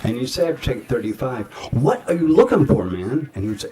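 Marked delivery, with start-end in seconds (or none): 1.7-3.3 high, loud exasperated demand; exasperated actor. Retold or imitated. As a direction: act out losing patience with the director. Acted exasperation after thirty-five takes.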